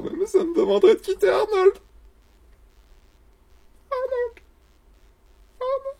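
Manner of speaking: voix suraiguë, comique, de détresse; gémir en fausset pour Arnold; couinement plaintif. Acted. Déchiré à l'idée de quitter Arnold. (plainte suraiguë, comique)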